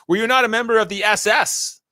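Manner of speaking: imitated hostile questioning; acted interrogation. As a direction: confront the witness like a grandstanding congressman. Acted congressman grilling a witness.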